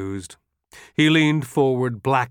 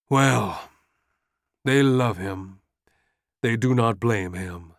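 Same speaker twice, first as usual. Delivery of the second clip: usual speaking voice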